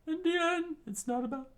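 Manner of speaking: very high screamed line, breaking off; Sanji's scream. Acted. Sanji cries out mid-battle, screaming. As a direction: shriek it out urgently. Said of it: "Sanji screamed out".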